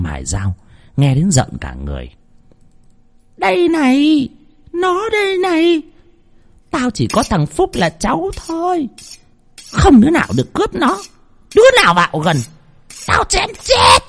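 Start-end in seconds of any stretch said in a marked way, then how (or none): 3.4-14.0 much higher, wild denial of a madwoman; a deranged grandma refusing the truth; crazed old woman. Acted. Bà Phú rejecting the news of her grandson, high crazed voice.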